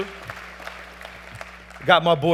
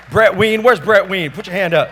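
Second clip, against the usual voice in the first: calling out; 5 semitones higher, 10 dB louder than usual. Real, not acted. Calling out to the crowd.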